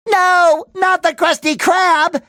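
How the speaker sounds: high, drawn-out cry of horror; comic dismay. Acted. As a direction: wail in melodramatic disbelief. Dismay at ruined restaurant.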